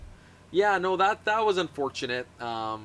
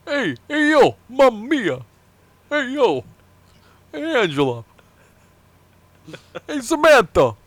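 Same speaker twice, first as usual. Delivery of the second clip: mock Italian accent; 8 semitones higher, 9 dB louder than usual. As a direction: call out like a Brooklyn Italian guy. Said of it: "based on your impersonation, he's Italian".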